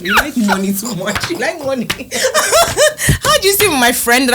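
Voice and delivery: laughing through the words. Real, not acted. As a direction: giggle while teasing. Laughing while scolding.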